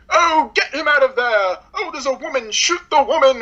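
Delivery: lightly hammed-up stiff Englishman; uptight British guy. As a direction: send up a stuffy British officer barking orders. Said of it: "typical, like, uptight British guy".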